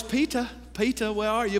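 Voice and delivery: a hint of movie Jesus in a British accent. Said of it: "Jesus played by ... just sounds smart".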